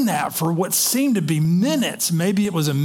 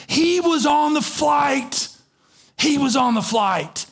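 much higher, slow, insistent; excited pointing out; 11 semitones higher, 3 dB louder, slower than usual. Retold or imitated. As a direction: retell her urgent tip-off to the pilot. Attendant pointing him out to pilot.

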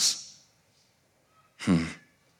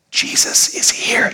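slow stage whisper; hushed announcement; slower than usual. Acted. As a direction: whisper the news slowly. Mary quietly announces Jesus first.

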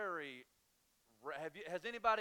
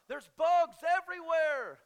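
bugs yelled high, loud and slow; panicky yell; 12 semitones higher, 15 dB louder, slower than usual. Retold or imitated. Wife shrieks about the bugs in panic, high loud. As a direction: shriek There's bugs everywhere. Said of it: "she's like".

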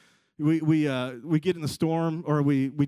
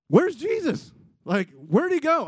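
high, panicky call for someone missing; hammed-up worried searching; alarmed searching; 9 semitones higher, 5 dB louder, slower than usual. Acting disciples looking around, alarmed.

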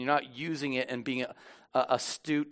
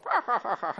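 high chuckle after a jibe; teasing laugh; 7 semitones higher than usual. Acted. Pastor teasing Joseph about his arm, laughing. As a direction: laugh at your own joke.

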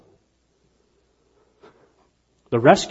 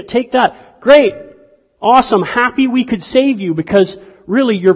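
higher, louder, generous rescuer voice; cheery fisherman; 5 semitones higher, 4 dB louder than usual. Retold or imitated. Fisherman beams at the castaways, hammed-up and cheerful. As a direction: hand over gifts with bright, eager pride.

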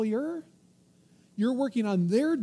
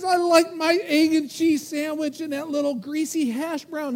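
the flesh craving; 6 semitones higher, 6 dB louder than usual. Acted. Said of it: "And the flesh says".

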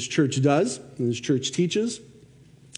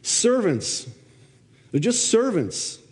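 Servants and servants said higher, with weight; preacher stressing servants, pitched up; emphasis on servants; 7 semitones higher, slower than usual. Shown as a demonstration. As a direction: lift and press the word servants. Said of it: "there's an emphasis on that word".

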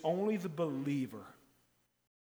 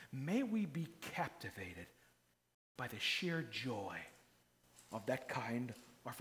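hushed prayer; 4 semitones lower, 7 dB quieter than usual. Acted. Quiet closing blessing.

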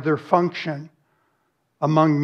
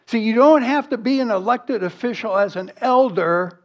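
ordinary talk, nothing marked

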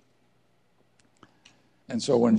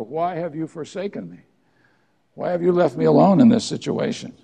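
raised, loud, pleading cry to the Father; anguished cry of Jesus; 6 semitones higher, 9 dB louder than usual. Acted. Jesus on the cross crying to the Father, anguished and loud. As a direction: cry it out as one abandoned on the cross. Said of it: "And he says to the Father".

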